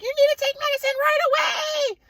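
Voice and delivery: shrieky, very high mother imitation; hysterical mom. Mom answering being told to leave, hysterical screech. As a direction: insist in a piercing mock-mom screech.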